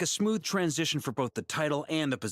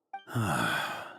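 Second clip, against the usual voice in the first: sigh; 11 semitones lower than usual. Acted. Weary at old names.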